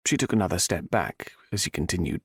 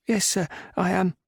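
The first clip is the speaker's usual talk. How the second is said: higher maid voice, a hint shaky; 11 semitones higher than usual. A narrator's reading. Maid answering Gray, shaken. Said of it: "she said in a shaky voice".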